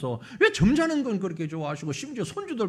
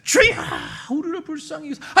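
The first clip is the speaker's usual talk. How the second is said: desperate shout; 5 semitones higher, 5 dB louder than usual. Acted. Blind men shouting over crowd.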